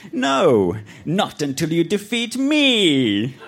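high, slowing villain refusal; undead lord. A narrator's reading. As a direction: refuse grandly, stretching the last words.

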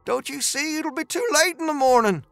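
high, desperate warning; agitated high-voiced protest; crying out. A narrator's reading. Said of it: "Dooley cried".

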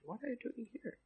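soft, high-pitched tender question; hushed, gentle voice of God. Acted. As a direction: ask it gently, as to someone fragile. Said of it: "He's very gentle".

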